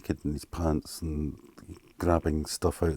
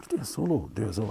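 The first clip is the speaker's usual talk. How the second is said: higher, urging bandmate voice, a touch mocking; pushy bandmate; 5 semitones higher, 4 dB quieter, much faster than usual. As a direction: egg him on to solo like the bandmate. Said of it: "And Alan's going".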